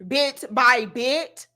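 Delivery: bit by bit, drawn out slowly; slowed down. Shown as a demonstration. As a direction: spell it out slowly.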